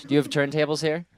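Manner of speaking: normal talking voice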